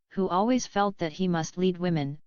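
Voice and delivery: ordinary delivery, nothing marked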